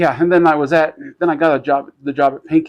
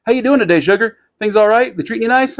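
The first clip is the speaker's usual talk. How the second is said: higher, louder, chummy check-in; friendly greeting imitation; 8 semitones higher, 4 dB louder, faster than usual. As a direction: play the genial boss making the rounds. Imitates friendly shop owner's greeting.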